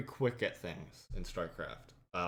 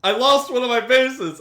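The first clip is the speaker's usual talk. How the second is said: mock panicked yell; 13 semitones higher, 14 dB louder than usual. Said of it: "Brandon goes".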